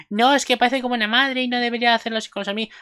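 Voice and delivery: high-pitched mock complainer voice; mocking commenters. Imitating complaining viewers in the comments, mocking voice. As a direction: put on a high voice to parody objectors. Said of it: "la gente en los comentarios diciendo".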